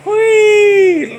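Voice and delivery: loud, high pig squeal; mock pig squeal, long and piercing; Ned Beatty's squeal. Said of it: "poor Ned Beatty was like".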